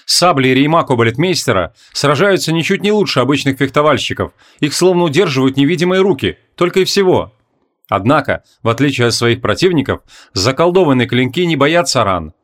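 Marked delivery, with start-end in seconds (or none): none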